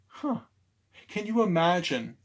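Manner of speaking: plain reading voice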